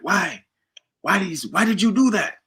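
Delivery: high, imitated Jamaican pleading; Jamaican accent. Jamaican roommate confronting Bo, accented wounded question. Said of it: "he was Jamaican".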